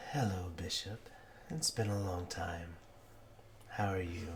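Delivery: soft, breathy little whisper; whispery little voice. Acted. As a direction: murmur it softly behind his shoulder. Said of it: "you hear a little voice go".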